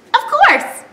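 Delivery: high squeaky agreement; puppet pig. Pretend. Puppet pig agreeing to cuddle, high. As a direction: answer as a little pig.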